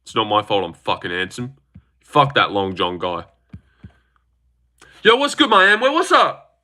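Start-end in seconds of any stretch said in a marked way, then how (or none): 5.0-6.6 high-pitched hype shout-out; excited greeting. Real, not acted. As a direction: greet a viewer in a soaring high voice. Streamer hails a viewer in chat.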